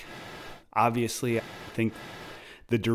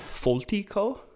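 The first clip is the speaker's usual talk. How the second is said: Italian accent; 10 semitones higher than usual. Shown as a demonstration. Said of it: "I have to say this with an Italian accent".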